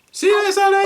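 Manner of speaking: high puppet voice. Acted. Puppet voice saying goodbye.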